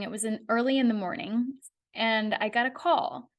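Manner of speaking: normal talking voice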